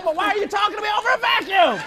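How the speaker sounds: hammed-up shout over a vacuum; mock shout. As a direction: yell as if over the vacuum noise. Mock complaint about vacuum.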